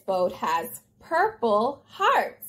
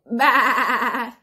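sheep bleat; 2 semitones lower than usual. A narrator's reading. Storyteller voicing the sheep in a picture book, bleating. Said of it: "Sheep said".

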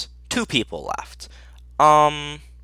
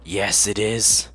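usual speaking voice

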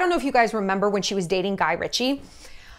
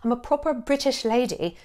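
a softer, put-on posh British lady accent, quietly hammed-up; mock British accent; 5 dB quieter than usual. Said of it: "adopted this faint yet unmistakable British accent".

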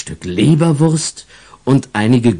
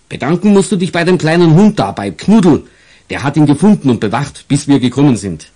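higher, louder friendly officer; kindly policeman; 4 semitones higher, 4 dB louder than usual. Acted. The officer tells the boy to thank the little dog, kindly. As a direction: tell the child kindly as the cop.

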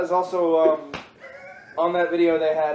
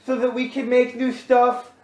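imitated young fan; 7 semitones higher than usual. Imitates a young convention questioner.

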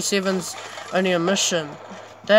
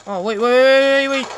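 loud, high, stretched "wait"; panicked plea; 6 semitones higher, 7 dB louder than usual. Real, not acted. Player caught off guard, panicked cry. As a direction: cry out to hold everything.

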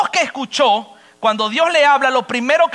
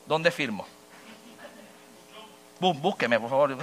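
mock timid mumble; 10 semitones lower, 10 dB quieter than usual. Preacher mocks a hesitant car buyer.

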